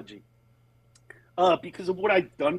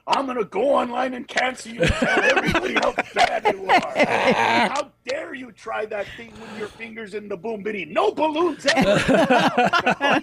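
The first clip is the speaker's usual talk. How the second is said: mock outrage; 7 semitones higher than usual.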